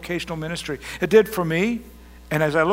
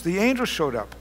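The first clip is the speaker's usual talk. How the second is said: usual speaking voice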